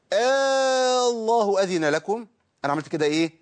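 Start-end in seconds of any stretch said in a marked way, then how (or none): 0.1-2.3 آه الله أذن لكم slow, opening stretched into a long alif; ibdal with long madd. Shown as a demonstration. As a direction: hold the first vowel long, then recite on. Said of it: "لو أبدلتها بألف أقول إيه؟".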